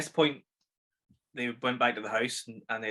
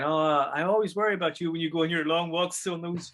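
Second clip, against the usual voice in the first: imitating Maude; 6 semitones higher, 5 dB louder than usual. Maude fretting to her husband about night walks, mock worried. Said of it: "she's saying".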